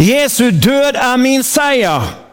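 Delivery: slow, proclaiming call for the congregation; preacher leading a spoken creed; leading a confession. Acted. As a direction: declare it for them to echo. Leads confession to repeat.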